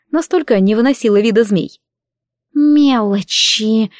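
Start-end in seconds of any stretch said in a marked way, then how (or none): 2.5-3.9 slow, stretched serpent hiss; hissing serpent dragon. Acted. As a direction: draw it out like a coiling snake. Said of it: "прошипела Альгиз".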